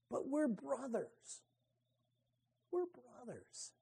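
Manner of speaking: hushed plea. Acted. Pleading brotherhood, trailing off.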